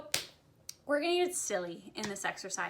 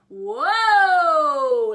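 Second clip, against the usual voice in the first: huge pirate holler, high then sliding down; pirate chest voice; 6 semitones higher, 17 dB louder than usual. Shown as a demonstration. Voice teacher demonstrating chest voice, loud pirate call. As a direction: belt it out long like a pirate. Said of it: "like, like a pirate".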